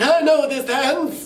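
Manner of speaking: put-on high, drawn-out holler from an old heckler; mock old man's loud, high cry; old guy in back. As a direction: call it out slowly as a hammed-up old man, far above your own pitch. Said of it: "one old guy in the back".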